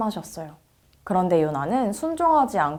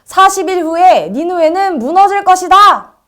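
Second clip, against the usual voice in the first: loud, high prophet's proclamation; high-pitched, forceful public cry; 10 semitones higher, 11 dB louder than usual. A narrator's reading. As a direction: shout the warning out over a whole city. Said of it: "이렇게 외쳤어요".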